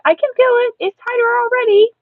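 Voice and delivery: put-on high, loud kid voice; eager childlike insistence; excited child. Child boasts tooth is tightening.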